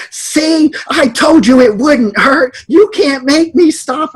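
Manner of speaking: put-on very high, slow taunting child shout; mock child's triumphant shout; imitating defiant child yelling. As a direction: yell it like a bratty four-year-old gloating. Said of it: "at top of her lungs".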